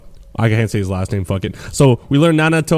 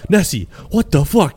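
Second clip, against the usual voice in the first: Mirai quote; 6 semitones higher than usual. Retold or imitated. Mirai voiced in retelling.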